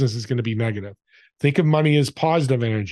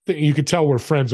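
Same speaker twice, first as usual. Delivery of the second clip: ordinary delivery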